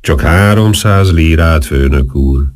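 ordinary delivery

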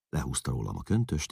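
plain reading voice

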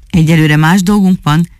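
plain reading voice

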